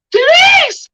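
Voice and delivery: very high, loud cry of the name; mock scandalized shout. Imagining her reaction to cursing mom, mock shout. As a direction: play-act being appalled, shrieking it.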